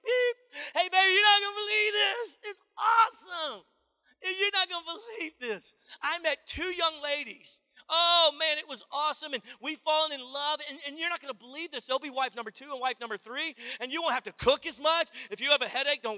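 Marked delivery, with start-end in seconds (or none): 0.0-0.4 mimicked voicemail beep, sharp and loud; high beep. The answering machine beeps before his message, high sharp beep. 0.8-15.1 high, loud gushing message; hammed-up thrill about the news; excited voicemail. A husband leaves his wife a voicemail, hammed-up excitement.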